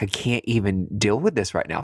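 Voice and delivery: normal talking voice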